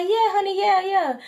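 high, sweet mock reassurance from the nail tech; imitating the nail tech. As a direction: chirp it like a salon worker soothing a client. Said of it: "She said".

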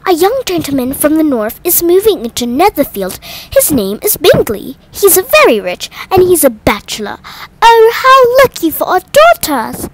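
high, eager matchmaking mother, rising to a cry; a scheming mother thrilled about a rich bachelor; gushing mother. A narrator's reading. Mrs Bennet voiced scheming.

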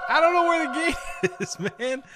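high, loud laughing panic; giggling terror, way up high; panicked laughing. Real, not acted. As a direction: laugh through the fear while yelling. Lost, dinosaur chasing.

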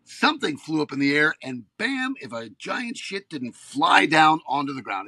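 ordinary delivery

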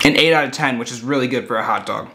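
muffled through a mouthful; talking while chewing. Real, not acted. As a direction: mouth full of food. Seen 1: Cheek bulges and jaw works on a bitten hot dog throughout.

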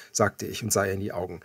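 plain reading voice

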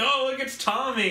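high, excited mock gasp of recognition; mock fan excitement. Mocking fans who spot Tommy, high excited squeal. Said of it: "of, like".

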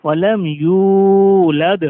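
ইউলাদ with the দ bounced out in a qalqala echo; slow, with a bouncing দ at the end of ইউলাদ; qalqala bounce. Shown as a demonstration. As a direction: recite slowly, popping the final দ of ইউলাদ. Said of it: "কলকলা মানে ধাক্কা দিয়ে পড়া".

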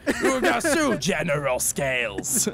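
slow made-up alien language; mock gibberish. Gamer mocking the game's invented language, slow and sing-song. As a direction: drawl fake gibberish names slowly. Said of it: "She's just like".

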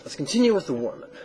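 quick, businesslike retake of the line; brisk restart. Shown as a demonstration. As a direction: snap back into host mode after the sigh.

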